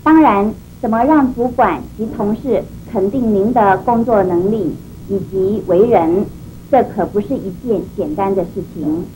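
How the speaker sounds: ordinary talk, nothing marked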